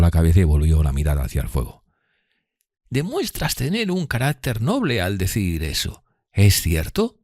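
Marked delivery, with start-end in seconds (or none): 2.9-7.2 slow, high lady's voice; kindly Miss Havisham. Acted. Miss Havisham questioning Pip kindly, high and slow. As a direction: speak slowly and earnestly, pitched up.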